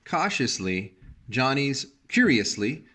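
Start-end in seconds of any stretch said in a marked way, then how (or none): none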